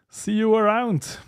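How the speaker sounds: usual speaking voice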